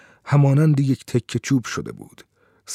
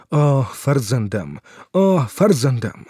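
ordinary delivery

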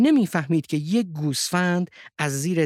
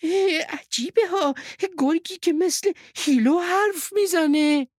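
high sheep voice full of wonder; astonished. A narrator's reading. Sheep wonders at a wolf talking like Hilo, astonished. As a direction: marvel at it in a high voice. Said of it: "با تعجب گفت".

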